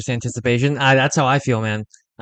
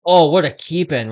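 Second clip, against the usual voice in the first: excited; 6 semitones higher, 7 dB louder than usual. Real, not acted. Great play on the ice.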